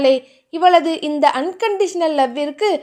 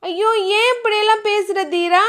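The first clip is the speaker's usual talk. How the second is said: hurt plea; 6 semitones higher than usual. A narrator's reading. Hurt girl answers angry man.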